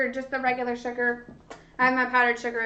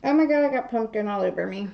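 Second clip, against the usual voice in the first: ordinary delivery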